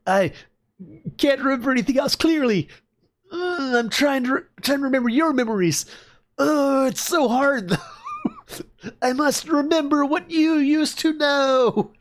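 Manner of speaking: high, strained, struggling to remember; strained character voice. Acted. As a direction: voice a high, pained struggle to recall. Voicing amnesiac old companion.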